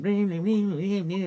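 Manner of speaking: high sing-song ring ring; playful ringtone imitation; mock phone ringing.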